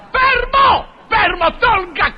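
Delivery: shout. Acted. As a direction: bark to freeze him. Doctor stops pest grabbing needle. (urlo alto e forte per fermarlo)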